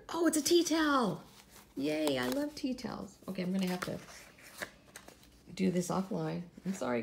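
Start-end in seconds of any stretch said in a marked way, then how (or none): 0.1-3.2 loud, high squeal of discovery; delighted. Real, not acted. Vlogger finds a tea towel in her giveaway box, delighted. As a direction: burst out happily, pitched up.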